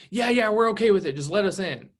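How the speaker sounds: just open the door already; impatient eager buyer. Retold or imitated. Buyers urging the agent at the door, excited and impatient. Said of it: "'Cause that's when they're so excited".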